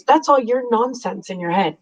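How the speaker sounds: normal talking voice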